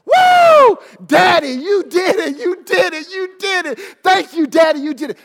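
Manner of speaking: high joyful shouts thanking daddy; thankful child's yell. Shown as a demonstration. Daughter should thank daddy, high joyful shouting. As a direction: yell it out, giving him credit. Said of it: "What you need to be saying is this".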